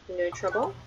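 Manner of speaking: ordinary delivery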